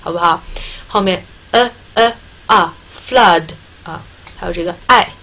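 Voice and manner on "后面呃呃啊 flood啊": 呃呃啊 then flood with the same open vowel, slowly; slow drill leading into flood; vowel demo. Shown as a demonstration. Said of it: "是这样发音的".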